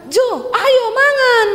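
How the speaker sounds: high, loud Sulawesi holler; angry-sounding call. Acted. Wife calling husband to eat, high blunt shout. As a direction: holler it with the end kept high. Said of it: "nadamu tuh nesu".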